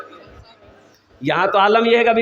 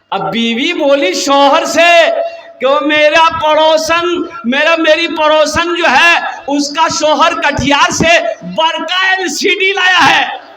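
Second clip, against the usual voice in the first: rising preaching shout; 6 semitones higher, 4 dB louder, slower than usual. Real, not acted.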